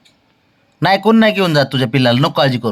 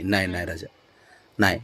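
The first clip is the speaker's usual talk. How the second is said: soft soothing; 10 semitones lower, 10 dB quieter than usual. Real, not acted. Soothing the anxious mother dog.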